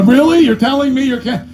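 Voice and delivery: high, loud disbelief; incredulous. Retold or imitated. Accountant mocking a broke client, incredulous. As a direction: scoff at the client's excuse in disbelief.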